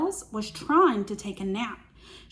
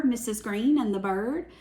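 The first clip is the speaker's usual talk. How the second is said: ordinary delivery, nothing marked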